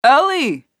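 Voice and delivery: loud call, high and strong; shouting. A narrator's reading. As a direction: shout her name across the yard. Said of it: "Nathan shouted from behind them".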